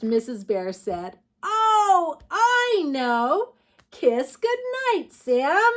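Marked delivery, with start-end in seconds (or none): 1.4-5.7 high delighted realization; light up with the answer; excited realization. Acted. Mother bear realizing.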